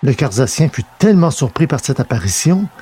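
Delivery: usual speaking voice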